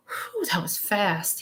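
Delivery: slow, soft, drawn-out whew; breathless amazement. Retold or imitated. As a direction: sigh it out in wonder. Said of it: "he says".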